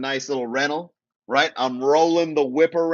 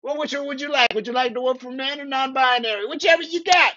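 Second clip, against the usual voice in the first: a much higher, sweetly polite put-on clerk voice; higher, prissy-polite clerk impression; mock progressive staff; 10 semitones higher, faster than usual. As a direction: voice the accommodating Austin server, high. Staff voiced in retold scene.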